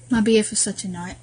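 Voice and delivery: usual speaking voice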